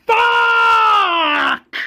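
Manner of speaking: long high-pitched shouted curse; drawn-out, loud howl of frustration; enraged scream. Acted. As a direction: scream it out in acted fury. Governor rages at the death.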